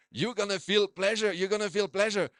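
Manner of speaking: put-on a doctor's German accent saying pleasure for pressure. German doctor reassuring a patient, in a German accent. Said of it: "Accents, huh?".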